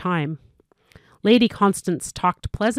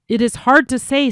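urgent; 5 semitones higher, 5 dB louder than usual. A narrator's reading. Messenger still urgent.

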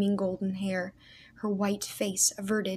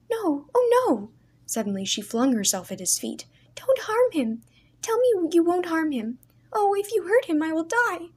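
much higher, louder terrified begging; frantic narrated pleading; desperate pleading; 9 semitones higher, 5 dB louder than usual. A narrator's reading. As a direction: beg him for the soldier's life. Leah begging at his feet.

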